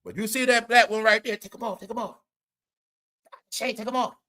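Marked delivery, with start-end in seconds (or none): 1.4-4.2 imitating a sassy auntie scolding, softer and higher; mock a stern auntie nagging under her breath. Assistant scolds [name] about the shades, sassy mock auntie.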